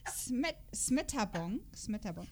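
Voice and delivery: normal talking voice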